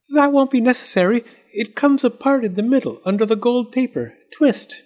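very high, urgent little doll; alarmed doll's voice. A narrator's reading. Doll stops Fletcher fetching an axe, high urgent voice. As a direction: instruct hastily in a high-pitched voice.

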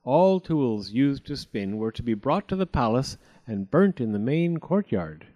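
plain reading voice

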